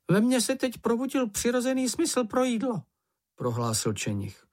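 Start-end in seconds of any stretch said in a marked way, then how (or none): 0.1-2.8 high-pitched little creature announcing hunger; much higher storybook creature voice; small creature voice. A narrator's reading. Čenich tells his companions he's hungry, high creature voice.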